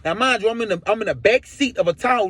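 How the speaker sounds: usual speaking voice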